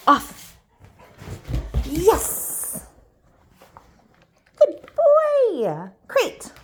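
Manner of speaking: talking to a dog. Real, not acted. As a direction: cheer the dog on, high and excited. Playing training game with dog.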